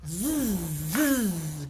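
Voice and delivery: soft buzzing fly sound; low fly buzz; buzzing zzz. Shown as a demonstration. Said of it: "So we're gonna start with".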